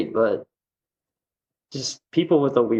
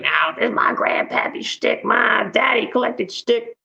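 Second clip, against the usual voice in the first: put-on Southern accent; 9 semitones higher than usual. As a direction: do old Charlie's folksy Alabama accent, stretched out. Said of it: "he's got a Southern accent".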